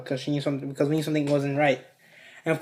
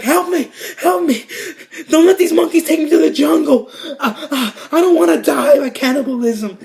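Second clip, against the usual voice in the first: high, wailing mock plea; loud, high retold hysterics; panicked pleading; 10 semitones higher, 5 dB louder than usual. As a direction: cry out for rescue in hammed-up terror. Friend pleading while being arrested.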